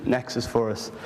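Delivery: ordinary delivery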